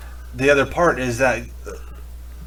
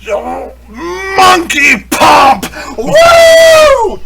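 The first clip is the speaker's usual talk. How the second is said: high, drawn-out, full-force holler; imitated scream; 11 dB louder, much slower than usual. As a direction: let out a wild drunken whoop. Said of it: "is just scream".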